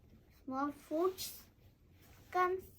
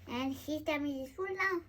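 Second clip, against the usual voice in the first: ordinary delivery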